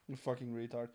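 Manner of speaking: normal talking voice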